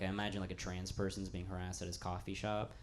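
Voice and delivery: usual speaking voice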